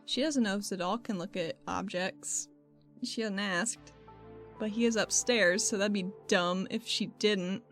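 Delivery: ordinary delivery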